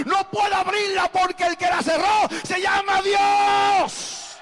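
high, loud, drawn-out anguished cry; preacher's full-force imitation of anguished Noah; almost crying, shouted. Noah answering those locked outside, almost crying at a shout. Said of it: "Noé decía casi llorando".

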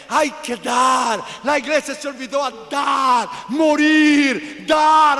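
loud, high exhortation with long-held words; full-force preaching. Real, not acted. Preacher scolding a church that forgot to give, impassioned shout. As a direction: cry out each demand to the congregation.

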